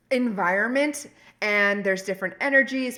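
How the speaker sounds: ordinary talk, nothing marked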